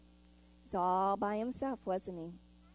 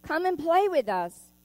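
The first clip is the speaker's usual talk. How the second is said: louder, higher call to a friend; friendly call; 5 semitones higher, 7 dB louder than usual. Acted. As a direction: invite him to play, calling across the water. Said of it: "they would call".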